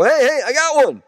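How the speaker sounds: high, loud, thrilled call of a hooked fish; excited shout. Retold or imitated. Shouting to the boat owner that a fish is on, excited. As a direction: yell it out like you just felt the tug.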